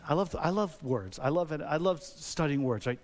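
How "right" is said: ordinary talk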